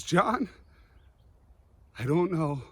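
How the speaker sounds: a mild retold yell breaking toward tears; crying yell. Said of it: "He starts crying and yelling out loud".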